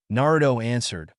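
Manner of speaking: usual speaking voice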